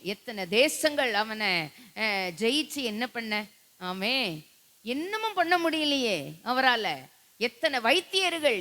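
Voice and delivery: usual speaking voice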